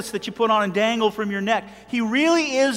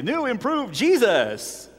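slow, drawn-out product pitch; mock advertiser; slower than usual. Preacher mocking how Jesus gets packaged, mock sales pitch. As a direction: announce it like a new product. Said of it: "package him up in a little package and say".

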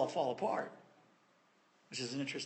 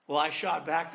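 ordinary talk, nothing marked

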